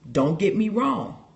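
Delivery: ordinary delivery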